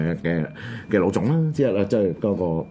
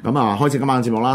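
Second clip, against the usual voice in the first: ordinary talk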